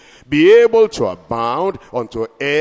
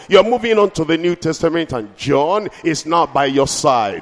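ordinary talk, nothing marked

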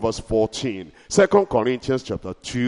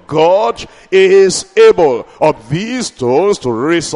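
slow, loud proclamation; preaching John's words; 7 dB louder, slower than usual. Acted. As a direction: declare it like the prophet. Quoting John, preaching.

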